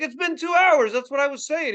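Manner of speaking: put-on high, indignant teenage comeback; exasperated protest. Teen arguing with dad after shoveling, exasperated.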